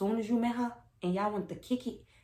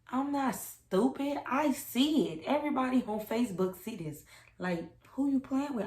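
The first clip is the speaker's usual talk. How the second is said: heated retort; 7 semitones higher, 5 dB louder than usual. Retold or imitated. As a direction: throw it back at the lying boyfriend. Confronting lying boyfriend, heated.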